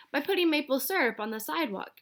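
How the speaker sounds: high, outraged retort; high outraged echo; indignant shout. A narrator's reading. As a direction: throw it back in disbelief. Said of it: "shouted Missus Mintry".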